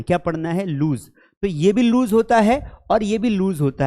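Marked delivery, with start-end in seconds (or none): none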